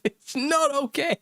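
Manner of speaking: very high mock toddler wail; upset small child. Dad imitating his son over lost crisps, upset child. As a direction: imitate a little boy losing it. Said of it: "he just loses it".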